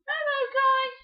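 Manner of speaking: put-on high, bright girl's greeting; high princess voice. Peach greeting the brothers in a high voice. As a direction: pitch way up for Peach.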